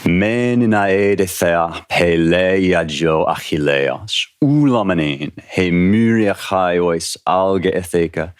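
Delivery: slow, chanted Homeric verse; rhythmic, stately reading of ancient Greek meter; ancient Greek recitation. Shown as a demonstration. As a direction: intone the Greek like a bard singing the epic. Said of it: "I'm gonna read those same lines, those same first five lines in Greek".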